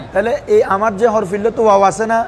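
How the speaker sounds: ordinary delivery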